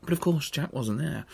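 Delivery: plain reading voice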